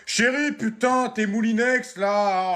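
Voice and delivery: loud, high, drawn-out complaint; exasperated shout. Acted. Husband tripping over wife's appliances, shouting in exasperation. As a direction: holler at your partner in exasperation. Parent shouts at partner about clutter.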